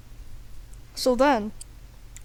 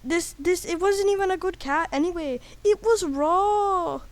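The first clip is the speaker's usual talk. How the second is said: indignant high caricature; Gordon Ramsay; 6 semitones higher than usual. Acted. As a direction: complain in a high put-on voice. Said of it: "Gordon Ramsay says".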